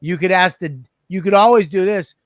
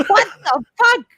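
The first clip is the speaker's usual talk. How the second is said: high laughing outburst; real stunned laugh; laughing disbelief; 13 semitones higher than usual. Real, not acted. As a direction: laugh out the question in shock. Shocked laughter.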